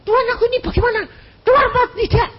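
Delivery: high, fast, hammed-up praying; mock panicked prayer. Preacher mocks a frightened believer praying: fast high mock panic.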